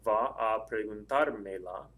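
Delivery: slow demo, "preguntarmela" stressed on "tar"; accent on the AR. Shown as a demonstration. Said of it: "add an accent mark to it".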